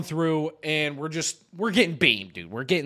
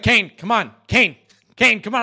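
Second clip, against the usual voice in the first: raised, urgent calling, imitated; insistent nagging; 5 semitones higher, 6 dB louder than usual. Imitating Jake calling Kane over, insistent nagging. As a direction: keep badgering a teammate to come.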